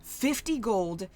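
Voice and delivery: loud, furious haggler yelling over a price; angry customer shouting. Acted. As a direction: yell it like you've been cheated. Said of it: "one person just goes".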